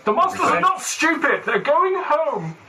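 very high, drawn-out comic insistence; mock protest. Player defending monsters that run home, mock protest. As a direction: stretch each phrase out high and sing-song.

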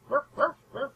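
high, yappy imitated barking; dog barks. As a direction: bark like a little spaniel. Cocker spaniel barking in clearing.

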